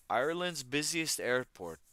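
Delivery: a light, half-hearted stab at an Irish lilt; mock Irish accent, reading the news; bad Irish accent. Streamer reading news aloud in a mock Irish accent. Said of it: "That was a terrible Irish accent".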